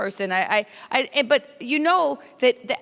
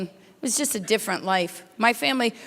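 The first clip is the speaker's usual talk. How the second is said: normal talking voice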